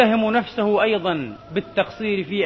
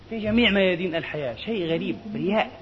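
normal talking voice

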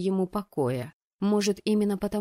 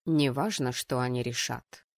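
dry, deeper male reply; dry fiancé; 7 semitones lower than usual. Acted. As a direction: dismiss it dryly in a lowered voice. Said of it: "сухо заявил жених".

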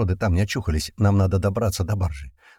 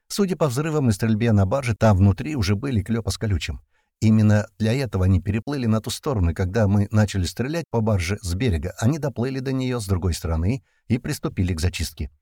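ordinary delivery, nothing marked

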